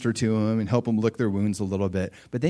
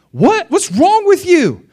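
much higher, louder mock-indignant shout; indignant outburst; 10 dB louder, slower than usual. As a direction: play the offended complainer, outraged. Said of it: "Then they're like".

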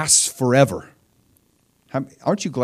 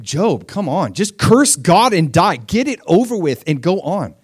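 higher, punchy mock urging from Job's wife; exasperated wife; 7 semitones higher, 5 dB louder than usual. Job's wife telling him to curse God, mock exasperation. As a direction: imitate a wife loudly urging her husband to quit. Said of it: "his wife actually says this".

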